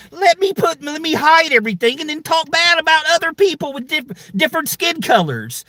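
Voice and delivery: high mocking whine; mock troll voice. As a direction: sneer as the little punk hiding online. Mimicking hiding racist.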